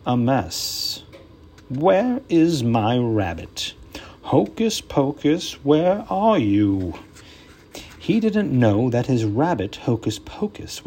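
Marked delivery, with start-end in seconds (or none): 0.1-6.9 magician voice. Acted.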